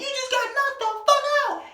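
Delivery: very high-pitched mocking squeal; jeering onlooker. Onlooker taunts him after the punch, high mock jeer. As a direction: crow over the loser like a bystander.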